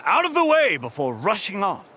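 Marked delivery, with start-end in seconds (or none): 0.1-0.8 high, raised quote of a hurried order; imitated urgent shout. Knight quotes the hurried vice captain to superiors, imitated shout. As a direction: mimic someone barging through. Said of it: "All he said was".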